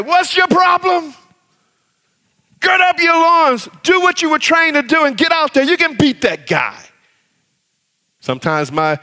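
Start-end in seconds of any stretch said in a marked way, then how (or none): 0.0-6.8 instructor yelling. Retold or imitated. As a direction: bark it at a scared student. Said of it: "we would bring them back and say".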